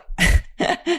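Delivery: a quick breathy laugh; short laugh. Real, not acted. Laughing at the absurdity of Scientology's line.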